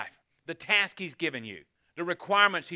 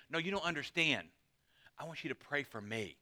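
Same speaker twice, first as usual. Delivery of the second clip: soft, earnest and intense; earnest plea; 8 dB quieter than usual. Pretend. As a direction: plead quietly, holding their gaze. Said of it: "look at you in the eyes and say".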